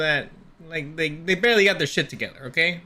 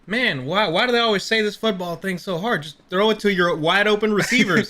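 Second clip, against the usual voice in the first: cowboy voice; 4 semitones higher than usual. Retold or imitated. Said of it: "why are you talking like a cowboy?".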